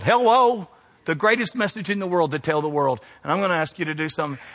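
usual speaking voice

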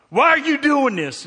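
high, loud mock outburst; mad guy. Stranger at the laundromat, a loud, mock-angry outburst. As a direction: bark it like a baffled angry man. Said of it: "it's like he's mad".

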